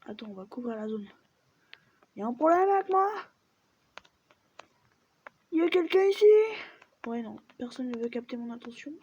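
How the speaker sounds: normal talking voice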